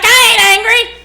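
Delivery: loud, high hollering grandma; grandma yelling. Retold or imitated. Grandma yelling at the kids, loud high holler. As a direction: holler it like an angry-sounding grandma. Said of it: "Why does your grandma yell so much".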